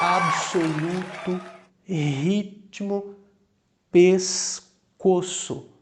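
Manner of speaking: absoluto, ritmo, pescoço slow, with the split consonants b-s, t-m, s-c sounded clearly; sounding each consonant. Shown as a demonstration. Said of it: "vão escutar exatamente o som de cada uma dessas consoantes". (pronúncia lenta e separada)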